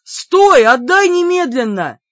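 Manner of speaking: high, loud order; loud commanding yell; outraged demand. A narrator's reading. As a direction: shout to halt someone running off. Бер требует вернуть торт.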